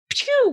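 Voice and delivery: quick imitated "pew" swoosh; sudden sound-effect zap; swiping pew. Shown as a demonstration. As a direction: fire off a pew like a claw swipe. Imitates a claw swipe.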